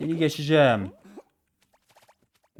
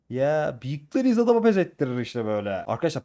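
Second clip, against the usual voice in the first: usual speaking voice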